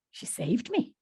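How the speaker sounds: hushed, dramatic whisper; confiding whisper. Acted. Barbara revealing her rescue to the interviewee, whispered. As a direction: whisper it like a revelation.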